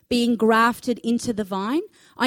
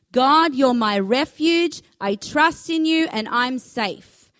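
louder and slower, leading the congregation; slow, emphatic declaration; 4 dB louder, slower than usual. Shown as a demonstration. Preacher leading the congregation in unison, slow and emphatic. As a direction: spell it out slowly so they can follow. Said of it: "Why don't you say it with me?".

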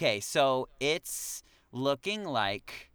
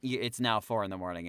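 ordinary delivery

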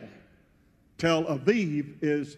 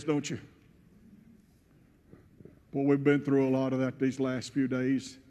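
ordinary talk, nothing marked

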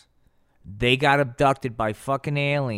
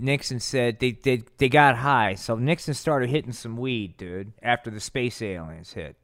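ordinary talk

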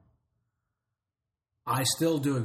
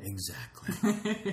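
sarcastic; 10 semitones higher, much slower than usual. Real, not acted. Sarcastic answer, barely any films.

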